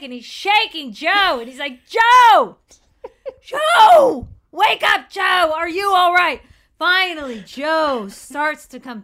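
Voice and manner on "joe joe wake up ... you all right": high, loud panicked yelling; panicked shout. Acted. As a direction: shake him awake, yelling in panic. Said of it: "So the friend starts to panic".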